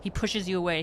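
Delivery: ordinary talk, nothing marked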